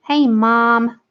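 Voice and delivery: amateur American greeting; attempted American accent. Shown as a demonstration. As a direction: put on a rough American accent. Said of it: "but you say mom".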